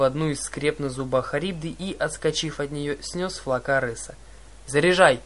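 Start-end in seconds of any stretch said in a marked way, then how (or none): 4.7-5.2 loud command to reload; shouted order. Acted. Clarisse ordering the crew to reload, loud command. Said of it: "вновь отдала приказ Клариса".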